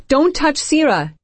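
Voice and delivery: high, loud, slow urgent cry; alarmed exclamation. A narrator's reading. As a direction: shout a desperate warning. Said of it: "Amy exclaims".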